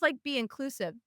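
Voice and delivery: usual speaking voice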